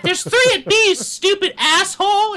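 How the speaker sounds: put-on very high kid voice, scolding; angry nine-year-old gamer. Child correcting an older teammate, squeaky and cross. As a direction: imitate an indignant little shot-caller. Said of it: "when they are actually nine years old".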